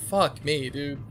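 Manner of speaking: normal talking voice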